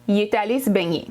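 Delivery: 'Il est' squeezed into one 'é' sound; Quebec contracted il est. Shown as a demonstration.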